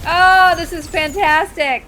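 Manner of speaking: loud, slow, high sarcasm; exasperated mock praise; sarcastic exclamation. Real, not acted. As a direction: stretch it out in loud mock delight. Sarcastic about being stuck.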